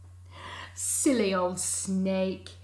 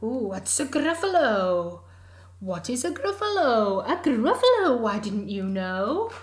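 surprised snake; 3 semitones higher, 9 dB louder than usual. Acted. Snake asks, mouse replies.

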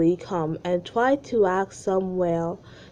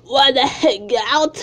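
put-on croaky, feeble sick kid voice; 6 semitones higher, 7 dB louder than usual. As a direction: sound like you have a cold. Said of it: "in a sick voice".

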